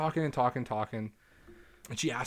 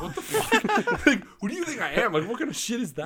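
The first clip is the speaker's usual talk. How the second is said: high, loud, laughing outrage; shocked and laughing at the nerve of it; outraged disbelief; 11 semitones higher, 7 dB louder than usual. Retold or imitated. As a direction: squeal it like you can't believe what you read. Indignant retort to lewd text.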